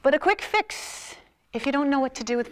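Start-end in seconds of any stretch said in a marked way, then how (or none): 0.0-1.2 much higher, slow, drawn-out; high swooping demo of vocal variety; demonstrating pitch. Shown as a demonstration. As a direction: lift it way up to show range. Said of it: "vocal variety".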